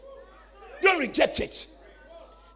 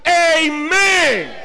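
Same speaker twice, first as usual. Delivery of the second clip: shouted amen; 2 semitones higher, 7 dB louder than usual. Shown as a demonstration. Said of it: "you have to shout".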